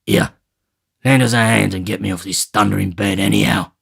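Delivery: impatient sick housebreaker; Sikes. Acted. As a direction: order her to help, surly. Sikes demands help.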